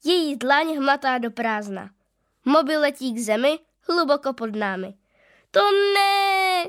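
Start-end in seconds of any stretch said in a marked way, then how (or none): 5.5-6.6 high, loud cry of dismay; a girl shouting in horror; despairing cry. A narrator's reading. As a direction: wail as the phone falls. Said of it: "křičí Tereska".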